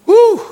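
high loud cheer of delight; joyful whoop. Real, not acted. Preacher exulting after the climax, whooping. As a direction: let out a whoop of joy.

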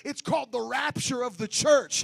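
loud, rising sung preaching; full force preaching. Acted. Preacher shouting holiness at the congregation, loud and sung. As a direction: whoop it up to the congregation.

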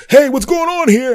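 high, loud officious call; security guard. Retold or imitated. Late-arriving security guard calling out to the crowd, high and loud. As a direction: bark a question as the guard. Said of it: "the security guard comes out".

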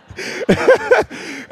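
a high airless burst of laughter; high breathy laugh. Real, not acted. Pastor laughing while telling his congregation about the old coach. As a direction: laugh out in disbelief at the memory.

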